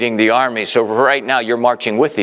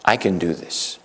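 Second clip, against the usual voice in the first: soft declaration; 5 semitones lower, 6 dB quieter than usual. Shown as a demonstration. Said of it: "Can you say that with me?".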